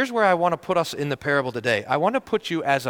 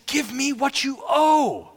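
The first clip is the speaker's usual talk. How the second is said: high, slow and menacing; menacing demand; 10 semitones higher, slower than usual. Acted. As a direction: spell out each word as a threat. Seizes the debtor.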